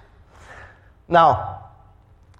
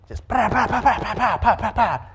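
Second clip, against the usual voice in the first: high, chuckling pa pa of crackling firecrackers; firecracker pops; 8 semitones higher than usual. Retold or imitated. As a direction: rattle them off like a string of firecrackers. Firecracker pack going off.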